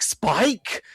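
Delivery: mock-outraged yelp in an English twang; English accent. Imitating Giles's shocked reaction for a co-host, mock English accent. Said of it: "little English accent twang on that Spike".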